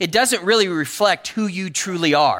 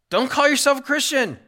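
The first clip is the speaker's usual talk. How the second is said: emphatic plea; 9 semitones higher than usual. Real, not acted. Preaching peak line.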